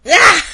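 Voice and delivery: sudden loud grunt of effort; strained cry. Real, not acted. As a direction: cry out under the weight. Hauling heavy stack.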